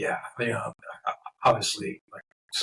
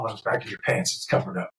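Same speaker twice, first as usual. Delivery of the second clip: ordinary talk, nothing marked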